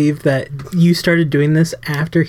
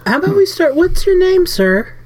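much higher, sugary polite voice; high sweet voice. Pretend. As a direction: play it innocent and high. Chirpy greeting to thug.

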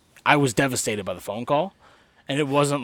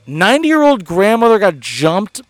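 grinning through bad news; 3 semitones higher, 6 dB louder than usual. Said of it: "smiling, looking at him".

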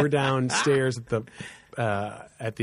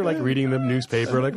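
ordinary talk, nothing marked

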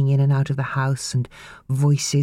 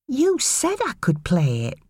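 put-on slow, high boy's voice, insisting; boy reminding her; 9 semitones higher than usual. A narrator's reading. Boy insisting to his mother, slow and high. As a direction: remind her slowly, hurt. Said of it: "Casey again reminded her".

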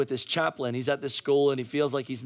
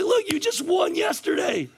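high, urgent retold pep talk; insistent coach's shout; 4 dB louder than usual. Coach reminding losing kids of yesterday, insistent shout. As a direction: shout sense into a discouraged team.

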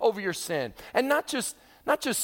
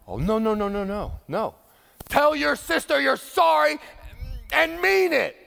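insistent parent; 5 semitones higher, 4 dB louder than usual. Acted.

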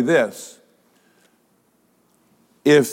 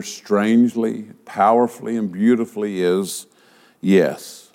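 usual speaking voice

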